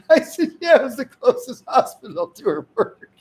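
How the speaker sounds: can barely get the words out for laughing; high giggly retelling. Real, not acted. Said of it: "laughing through the story".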